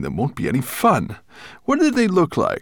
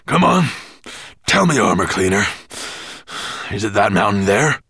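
loud, impatient, breath-broken questioning; panting dragon; 7 dB louder, faster than usual. A narrator's reading. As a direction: demand an answer between heavy pants. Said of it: "he panted".

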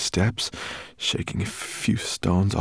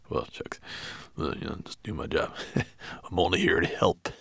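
bashful dwarf; 2 semitones lower, 6 dB quieter than usual. A narrator's reading. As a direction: shrug off the praise, chuckling. Dwarf flattered.

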